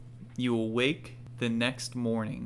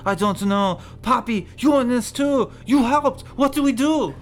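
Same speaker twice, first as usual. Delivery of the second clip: panicked chicken voice, climbing higher and louder; panicking; 6 semitones higher, 7 dB louder than usual. Acted. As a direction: build from cursing to frantic pleading. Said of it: "they are in a panic saying".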